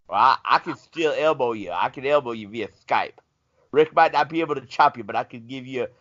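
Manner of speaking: ordinary delivery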